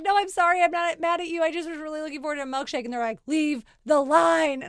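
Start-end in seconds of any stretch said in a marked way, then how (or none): 0.0-2.8 high, insistent backpedaling; pleading apology. Retold or imitated. Retold pleading apology to the worker. 3.2-4.6 loud, slow, each word separate; imitating an insistent drive-thru worker; firm order. Quoted worker repeats the order firmly.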